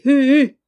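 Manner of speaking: loud, high hoot of despair; a lost sailor's wail of hu hu. A narrator's reading. Lost at sea, despairing cry. (cri désespéré et aigu, hu hu)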